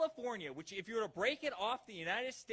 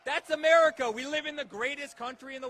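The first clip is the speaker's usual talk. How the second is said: loud, higher pitched rally cry; proud shout; 6 semitones higher, 11 dB louder than usual. Real, not acted. Speaker rousing the rally crowd, shouting proudly. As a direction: punch it out for applause.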